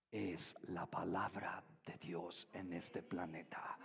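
soft intimate preaching. Acted. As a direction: draw them in with a near whisper. Soft intimate sermon passage. (predicación susurrada e íntima)

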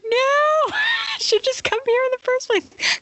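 hammed-up sob of self-pity; mock crying wail. Retelling her Costco meltdown to Chris, a hammed-up crying wail. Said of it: "I start crying".

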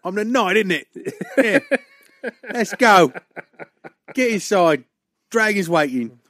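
bad stagey Cockney; Cockney accent.

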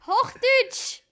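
loud shout to be understood. Real, not acted. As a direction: yell it so she hears it through the music.

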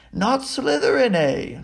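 small, high, questioning voice; high-pitched little musing voice. A narrator's reading. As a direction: echo it back high, as a question. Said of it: "said the small voice".

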